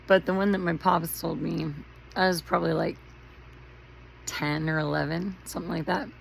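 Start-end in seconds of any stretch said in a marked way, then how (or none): none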